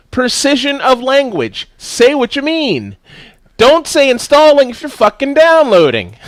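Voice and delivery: high, slow, emphatic lecture with stressed words; lecturing rant. Retold or imitated. Lecturing a gamer friend, high emphatic rant. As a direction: lay down the law to a friend who misspoke. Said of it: "and I said".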